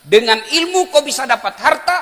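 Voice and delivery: loud, high, emphatic restatement; emphatic repeat. Shown as a demonstration. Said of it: "Saya ulang". (pengulangan tegas, keras dan bernada tinggi)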